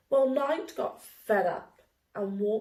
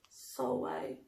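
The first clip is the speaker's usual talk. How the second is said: muttered; 3 semitones higher, 9 dB quieter than usual. A narrator's reading. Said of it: "she muttered".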